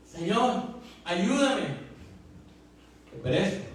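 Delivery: retold cry for help. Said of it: "empezó a gritar".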